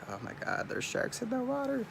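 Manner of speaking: high-pitched scared wife voice, hammed up; mock panicky wife. Imitating his wife scared of sharks, high mock panic. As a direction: squeal it like the frightened wife. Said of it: "She's like".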